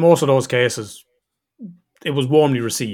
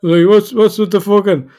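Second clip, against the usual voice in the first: higher, louder mock protest; retold startled protest; 7 semitones higher, 7 dB louder than usual. Son answering his father on the phone, higher and louder in indignant surprise. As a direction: act out being caught off guard, indignant. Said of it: "I'm like".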